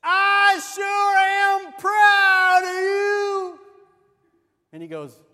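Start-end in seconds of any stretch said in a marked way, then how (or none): 0.0-3.6 very slow, high, full-volume holler; hammed-up exasperated yelling, words stretched out; frustrated shout. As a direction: bellow every word at a deaf husband. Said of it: "she's getting a little frustrated".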